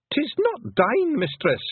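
high, slow, loud pixie shriek; a Feegle shrieking in indignant panic. Acted. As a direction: shriek it out in protest. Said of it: "shrieked Rob Anybody".

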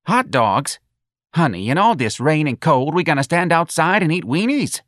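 high, swooping disbelieving questions; incredulous mother. Acted. Mother objecting to hot dogs in rain, incredulous. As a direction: balk at it, pitch climbing to the end. Mother balks at eating outside.

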